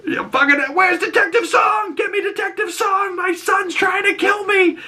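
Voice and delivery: very high hammed-up yell for help; mock panicked shout. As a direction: scream for the detective in mock panic. [name] yells for Detective Song.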